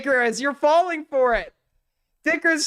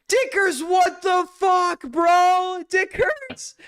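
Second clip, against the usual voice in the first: stunned betrayal shouted at a co-host; gasping at a co-host who caves; shocked outrage; 7 semitones higher, much slower than usual. Real, not acted.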